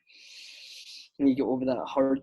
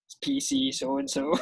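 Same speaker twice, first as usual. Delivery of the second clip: lightly voiced copper on the phone, pitched up high; officer ringing Mister Rodgers, a pitched-up mock formal voice; mock police officer. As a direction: play a cop phoning the football manager. Imagined police phone call.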